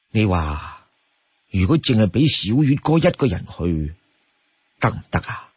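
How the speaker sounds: low, hesitant leader's question; Song Jiang. Acted. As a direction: ask doubtfully, weighing the risk. Worried leader asks Lu Junyi.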